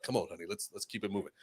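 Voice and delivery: low-key mumble to move along; husband hushing. Retold or imitated. Husband urging his wife to move on, hushed. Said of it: "her husband was like".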